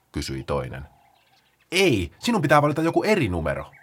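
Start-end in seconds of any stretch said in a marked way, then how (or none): 1.7-3.7 high and quick, slowing at the end; eager Paula. Acted. Paula rattles off the rules to the sloths, high and fast. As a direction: explain it like an excited organiser.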